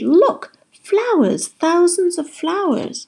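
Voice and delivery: high, wondering cries; excited shout. A narrator's reading. Polly showing Jessie the flowers, shouting excitedly. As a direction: shout with amazed delight, stretching the words. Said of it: "she shouted".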